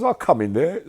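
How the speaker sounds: high, loud dare; defiant dare. Retold or imitated. Yummy daring the men in the cell, loud retold dare. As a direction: call it out like you mean to fight. Retelling a prison confrontation.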